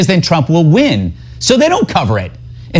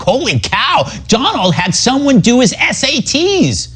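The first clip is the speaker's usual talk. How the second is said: high-pitched fake gasp of gossip; mock excitement; 10 semitones higher than usual. Host parodies media thrilled by Trump book gossip, mock excited. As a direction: play up trivial scandal, voice jumping high.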